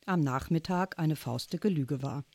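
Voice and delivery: ordinary delivery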